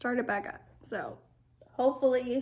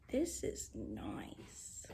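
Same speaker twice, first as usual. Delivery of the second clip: lower, whispered approval; awed low whisper; low hushed whisper; 14 semitones lower, 10 dB quieter, much slower than usual. Real, not acted. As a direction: breathe it out, much lower and softer.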